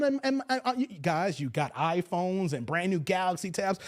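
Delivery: ordinary delivery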